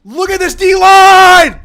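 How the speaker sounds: screamed very high and loud, slow; excited scream. Real, not acted. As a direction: stretch out a huge scream. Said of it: "We get a little excited".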